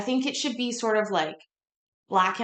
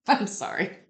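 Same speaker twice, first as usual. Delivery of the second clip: ordinary talk, nothing marked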